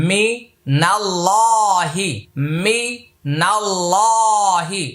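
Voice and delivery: মি নাল্লাহি with a thick L and drawn-out vowel; long, heavy lam. Shown as a demonstration. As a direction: stretch the "লা" in নাল্লাহি slowly, with a heavy L. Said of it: "এটা এক আলিফ টান হবে, মোটা হবে". (নাল্লাহি-র লাম মোটা, এক আলিফ টান)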